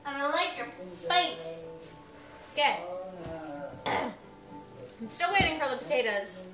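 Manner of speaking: normal talking voice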